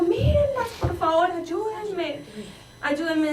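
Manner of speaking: high, wailing cry for help; acting out a terrified daughter begging; retold frantic plea. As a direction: pitch soaring, then sinking as the begging goes on. Daughter screaming for help, acted out.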